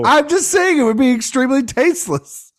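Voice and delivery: high, loud, laughing self-defense; laughing protest. Real, not acted. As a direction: protest loudly through laughter, pitch way up. Protesting over co-host's shocked laughter.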